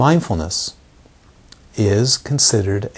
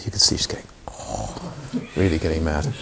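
hushed aside; 8 semitones lower, 9 dB quieter than usual. Real, not acted. Hushed aside about her anger.

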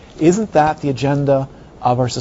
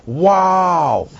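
a high, pretend burst of awe; mock wow; 5 semitones higher than usual. Teacher mocks awe at an empty Coke bottle, mock wow. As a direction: feign amazement at an empty bottle. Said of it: "it's empty, you go".

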